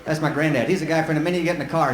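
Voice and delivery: ordinary talk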